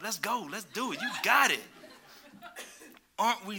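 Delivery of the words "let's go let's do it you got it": pumping her up. Retold or imitated. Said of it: "I was pumping you up".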